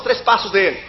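usual speaking voice

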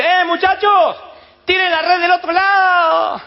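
high, loud, slow call across the water; shout from the shore. Acted. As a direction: bellow it across to the fishermen.

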